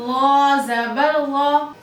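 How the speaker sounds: वा जबर वा drawn out very slowly and thick; careful Quran letter drill; extra-heavy drill. Shown as a demonstration. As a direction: spell out वा slowly and heavily. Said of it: "कैसे पढ़ेंगे वा को".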